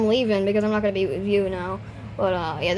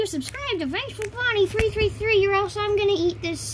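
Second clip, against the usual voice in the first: squeaky voice; 8 semitones higher than usual. Acted. High voice channel plug.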